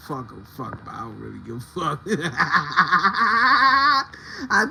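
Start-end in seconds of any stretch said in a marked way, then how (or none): 2.0-4.4 loud cackle. Real, not acted. Laughs loudly.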